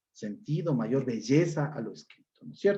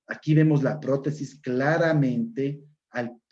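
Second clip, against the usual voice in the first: ordinary talk, nothing marked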